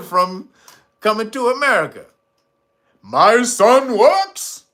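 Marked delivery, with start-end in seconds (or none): 3.0-4.6 Jaffe Joffer. Retold or imitated. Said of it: "Jaffe Joffer".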